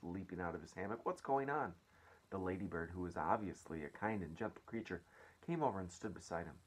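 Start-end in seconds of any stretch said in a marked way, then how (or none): 1.0-1.7 raised-pitch, startled question; startled cry. A narrator's reading. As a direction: ask it higher, in mild alarm. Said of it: "cried James".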